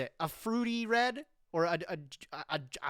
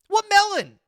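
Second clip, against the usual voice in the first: much higher, loud mock demand; 13 semitones higher, 13 dB louder than usual. Streamer imagining a vague friend, loud demanding question. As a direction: pounce on the vague answer.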